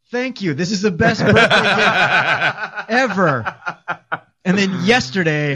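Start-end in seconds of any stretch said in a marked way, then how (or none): 0.1-3.5 slow laughing thanks, pitched up high; laughing relief. Retold or imitated. On hearing the actor is out, laughing slowly. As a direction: laugh out a grateful thank you. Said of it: "And I was like".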